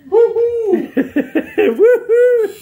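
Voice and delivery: high, loud celebratory cheer; triumphant woo-hoo after finishing the job; excited whoop. Real, not acted. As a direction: whoop it out for the camera.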